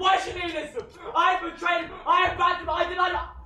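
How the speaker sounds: loud, high, laughing shouts of guilt; frantic shout. Acted. Faustus to scholar, shouting that he betrayed God. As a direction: rail against yourself, half laughing.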